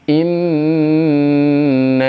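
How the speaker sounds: recited Inna with a held nasal n; Quranic recitation demo. Shown as a demonstration. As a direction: chant "Inna" in tajwid style, holding the n. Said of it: "cukup segitu".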